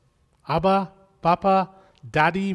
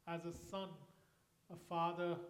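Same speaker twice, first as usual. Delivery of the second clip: ordinary delivery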